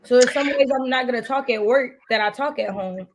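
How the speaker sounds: usual speaking voice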